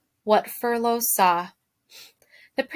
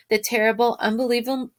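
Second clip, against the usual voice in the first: ordinary delivery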